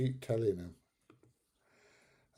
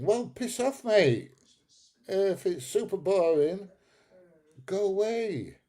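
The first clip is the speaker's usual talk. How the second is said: high, raised-voice brush-off; exasperated dismissal; 8 semitones higher, 4 dB louder than usual. Real, not acted. Streamer telling a bored viewer to leave, exasperated. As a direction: send the heckler packing, pitch climbing.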